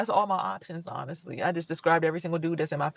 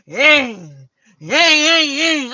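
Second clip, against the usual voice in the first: engine imitation; 7 semitones higher, 11 dB louder, much slower than usual.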